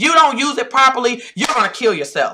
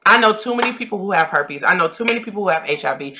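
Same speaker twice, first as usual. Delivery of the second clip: normal talking voice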